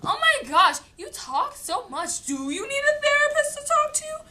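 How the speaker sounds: high, loud mocking parent voice; hammed-up fed-up mom; exasperated parent. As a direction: whine it like an annoyed parent. Parents complaining she talks too much.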